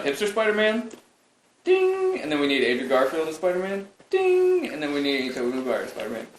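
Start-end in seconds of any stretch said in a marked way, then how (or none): 1.6-2.2 a high, bright chime sung on one note; high sung ding. Pretend. Host chiming in a picture cue, high ding. 4.1-4.7 a high, bright chime sung on one note; high sung ding. Pretend. Host chiming in a picture cue, high ding.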